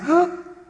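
sharp, high gasp of shock; startled gasp. Acted. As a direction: gasp as if ambushed. Servant sees troops outside.

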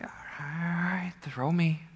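soft, subdued giving-in; quiet reluctant surrender; resigned Jonah. Acted. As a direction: drop to a hushed admission after the panic. Jonah owning up amid panic.

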